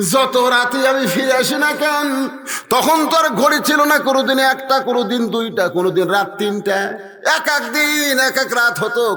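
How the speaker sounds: high, rolling sermon cadence; full-force preaching. Acted. Preacher voicing the Prophet recalling late nights with Khadija, full-force preaching. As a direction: preach it high, phrase after phrase.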